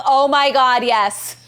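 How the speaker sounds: loud, emphatic burst of agreement; excited agreement. Real, not acted. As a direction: exclaim it in real excitement. Excited agreement with chat's message.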